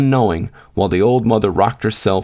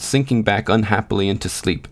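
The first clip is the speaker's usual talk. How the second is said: ordinary delivery, nothing marked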